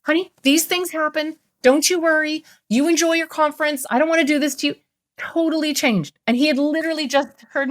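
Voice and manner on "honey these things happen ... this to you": higher, reassuring honey-voice; wife softening, mock tender; soothing wife. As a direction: comfort him now that he owns it. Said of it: "she was like".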